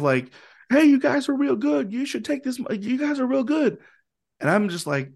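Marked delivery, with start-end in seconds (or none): 0.7-3.8 put-on high-pitched, gushing drunk guy; drunk fan. Drunk fan pressing a tip on the band mid-song, high and effusive. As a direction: imitate a tipsy fan, pitched way up. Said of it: "acting like your typical drunk guy".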